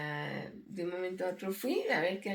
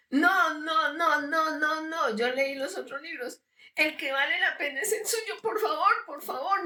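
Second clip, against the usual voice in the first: pleading stranger; 7 semitones higher, 3 dB louder than usual. Retold or imitated. Stranger begs her to publish.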